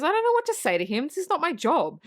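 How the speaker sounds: quick, high-pitched retold objection; flustered protest. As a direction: protest that it's someone else's problem. Acts George protesting to the other.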